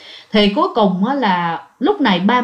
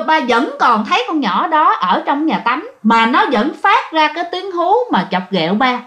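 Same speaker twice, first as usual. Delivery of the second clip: plain reading voice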